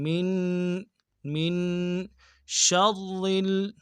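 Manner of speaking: من with the nun nasalized and held; nasal ghunna. Shown as a demonstration. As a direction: hum the nun of من. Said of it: "غنہ کرتے ہیں". (من میں نون پر غنہ)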